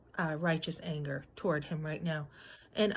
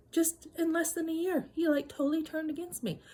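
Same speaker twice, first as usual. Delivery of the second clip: real disbelief; 8 semitones higher than usual. Real, not acted.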